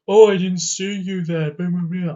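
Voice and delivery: exasperated imitation, fading into blah-blah; mock frustration. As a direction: parody an annoyed driver's outburst. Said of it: "he's getting all frustrated".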